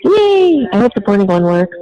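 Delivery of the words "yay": very high, bright "yay"; excited cheer. Real, not acted. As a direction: cheer with delight. Mom cheering the news.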